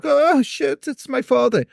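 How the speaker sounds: put-on high, alarmed squeak of a caught kid. Boy caught by his father, high startled squeak. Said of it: "But Hans was like".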